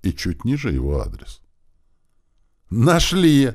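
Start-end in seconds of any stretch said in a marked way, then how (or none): 2.7-3.5 sudden gleeful exclamation; blurted out. A narrator's reading. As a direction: blurt it out, about to laugh. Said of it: "выпалил я и засмеялся".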